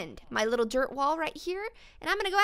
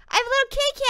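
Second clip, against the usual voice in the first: high, loud gush over the kitty; excited; 11 semitones higher, 9 dB louder, much faster than usual. Real, not acted. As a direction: squeal with delight at the new pet. Excited about new pet.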